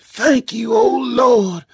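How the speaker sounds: high, tearful voice of Ma praying; fervent whispered prayer. A narrator's reading. Ma thanking the Lord through tears, fervent prayer. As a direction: pray fervently, high and overcome. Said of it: "whispered fervently".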